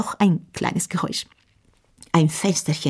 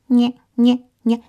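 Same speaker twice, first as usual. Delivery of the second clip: squeak; 6 semitones higher, 5 dB louder than usual. Acted. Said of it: "fing an zu quietschen".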